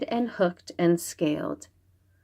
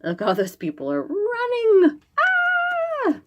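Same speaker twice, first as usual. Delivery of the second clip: high scared voice rising to a shriek; frightened cry. Acted. Townsfolk crying out as the monster comes, frightened. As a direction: cry out in fright as people flee.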